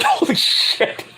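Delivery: slow whispered amazement; stunned whisper. Retold or imitated. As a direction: breathe it out in shock. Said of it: "I was like".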